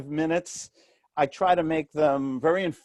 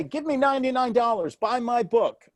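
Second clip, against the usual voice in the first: higher, pushy sales pitch; hammed-up hard sell, lightly voiced; mock pushy salesman asking for money; pushy seller; 7 semitones higher than usual. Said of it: "Why didn't I just say".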